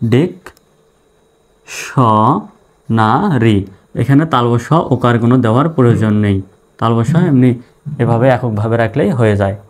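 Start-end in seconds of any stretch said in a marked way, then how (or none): 0.1-3.6 ডিক, স, না রি with long pauses; syllable by syllable. Shown as a demonstration. Teacher repeating the word's parts for learners, syllable by syllable. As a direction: pull dictionary apart into spaced syllables. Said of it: "dictionary বানান হয়ে গেল".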